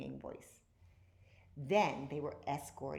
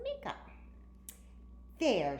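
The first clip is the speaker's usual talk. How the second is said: squealing; 5 semitones higher than usual. A narrator's reading. Girl squeals at her dog dressed as a human. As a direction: squeal it out, proud of the makeover. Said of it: "she squealed".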